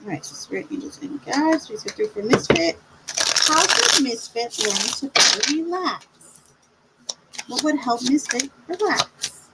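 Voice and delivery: invocation. Acted. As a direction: softly summon the guides. Calls on spirit guides.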